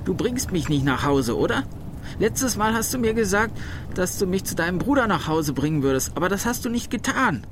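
put-on high-pitched, agitated old woman; accusing calling out. A narrator's reading. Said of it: "rief sie". (aufgebracht und vorwurfsvoll rufend)

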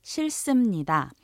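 싫습니다 said quickly at natural speed; 싫습니다 빠르게. Shown as a demonstration. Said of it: "you could also say".

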